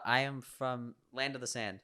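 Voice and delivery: slow, quiet, dramatic; mock grand. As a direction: deliver it like a legend. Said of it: "joking grand claim, slow".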